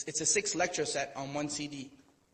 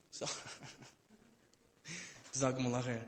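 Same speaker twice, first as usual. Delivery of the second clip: ordinary talk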